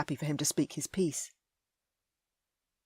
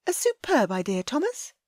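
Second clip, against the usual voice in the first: sweet to Thomas; 8 semitones higher, 4 dB louder than usual. A narrator's reading. Ellie sweet to Tidmore.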